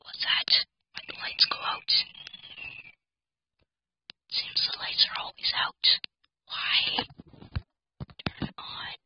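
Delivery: frightened whisper in the dark. Real, not acted. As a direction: hush it, scared. Scared whispering in dark game.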